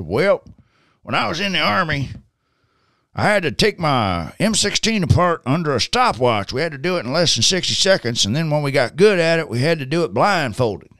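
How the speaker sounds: high-pitched Southern storyteller; thick Southern accent. Retold or imitated. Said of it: "it's this very thick Southern accent".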